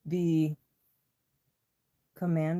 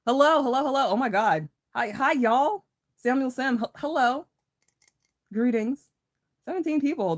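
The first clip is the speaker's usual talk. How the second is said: high loud excited greetings; 8 semitones higher, 5 dB louder than usual. Real, not acted. Greeting viewers joining live.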